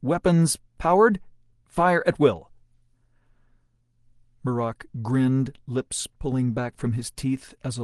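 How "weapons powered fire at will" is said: loud, high call to fire; shouting orders over battle. A narrator's reading. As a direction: bark the order to open fire. Leif calls battle orders.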